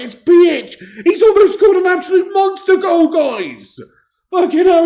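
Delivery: high, very loud football frenzy; real excited shouting. Real, not acted. Fan watching Australia almost score a monster goal, excited shouting. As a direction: yell it at the top of your range. Near goal by supported team.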